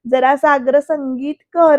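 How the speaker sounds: loud, drawn-out mock bossiness; slow, emphatic imitation of granny's orders; mimicking Nani's orders. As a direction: copy a demanding granny, stretching the words. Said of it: "नानींची copy करते ही मना".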